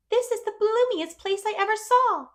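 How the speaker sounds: high, loud delighted exclamation; exclaiming. A narrator's reading. Anne riding in the buggy, exclaiming with delight. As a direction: burst out in wonder. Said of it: "she exclaimed".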